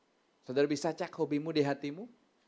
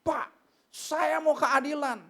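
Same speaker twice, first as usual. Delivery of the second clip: imitating a fierce complainant, loud; hammed-up indignant protest; mock angry; 12 semitones higher, 5 dB louder, slower than usual. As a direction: demand it like an irate businessman. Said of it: "marah-marah dia".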